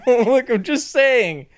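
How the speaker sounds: high, slow, laughing plea; giggling excuse. Real, not acted. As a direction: laugh through the words.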